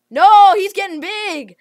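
loud, high, slow alarm; real alarmed outburst; alarmed shout. Real, not acted. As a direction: cry out as the threat grows. Rival growing in game.

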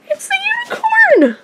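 high, fast squeal of delight; excited squeal. Real, not acted. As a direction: shriek with real joy. Finding the rare unicorn toy.

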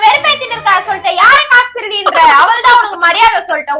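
high, loud indignant tirade; angry shouting. Real, not acted. Girlfriend protests being called a money thief, angrily shouting. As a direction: rail at him for the accusation.

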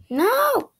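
a sharp high cry of "No!"; anguished cry. Real, not acted. Gamer crying out as the character falls, high cry. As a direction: yelp it out in shock.

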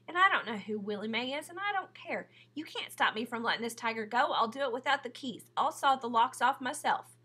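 put-on stubborn angry girl refusing; defiant. A narrator's reading. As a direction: stand your ground, defiant. Sistine defiant argument.

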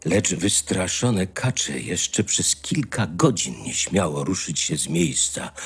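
ordinary talk

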